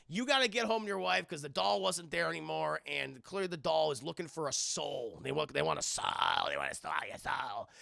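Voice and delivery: normal talking voice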